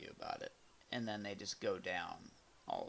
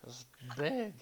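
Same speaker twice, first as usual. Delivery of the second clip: put-on higher whiny drunk patient voice; hypochondriac; 7 semitones higher than usual. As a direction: play a drunk hypochondriac fussing over his foot. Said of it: "drunk hypochondriac voice".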